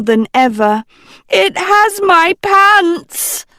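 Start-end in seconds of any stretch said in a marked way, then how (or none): 1.3-3.4 high, loud, slow shriek. A narrator's reading. A girl bitten by a snake shrieking to everyone, high and loud. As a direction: squeal it in panic. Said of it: "Dot shrieked".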